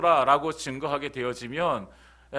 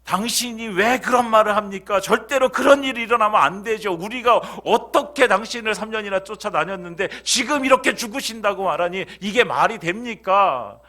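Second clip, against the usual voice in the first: much higher, much louder scolding; fierce rebuke; 9 semitones higher, 9 dB louder than usual. Shown as a demonstration. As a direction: rebuke him fiercely, outraged. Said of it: "이렇게 책망하는 거예요".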